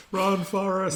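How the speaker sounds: put-on high, loud cheer of kids. Kids cheering their teacher as he runs past, high and loud. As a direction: call out like a kid in the corridor.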